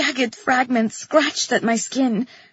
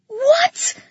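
very high, shocked scream; high-pitched shriek of alarm; panicked scream; 14 semitones higher, 3 dB louder than usual. A narrator's reading. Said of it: "I screamed".

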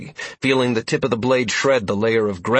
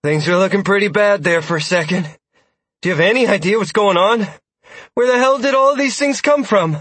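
out of breath, loud and high; breathless; 9 semitones higher, 5 dB louder than usual. A narrator's reading. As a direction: speak while catching breath. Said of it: "Sierra said breathlessly".

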